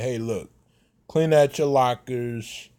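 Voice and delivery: ordinary talk, nothing marked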